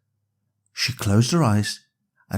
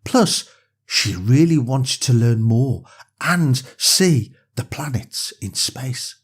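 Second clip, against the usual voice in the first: usual speaking voice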